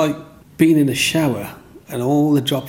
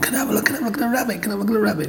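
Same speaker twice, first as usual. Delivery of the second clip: put-on eager kids; 10 semitones higher, much faster than usual. Kids crowd round begging to look.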